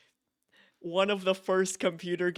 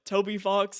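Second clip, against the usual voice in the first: ordinary delivery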